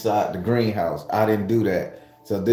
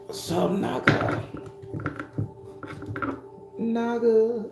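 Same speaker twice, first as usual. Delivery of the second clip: high squeaky voice; 13 semitones higher, 6 dB quieter, slower than usual. Pretend. Greeting in a put-on high voice.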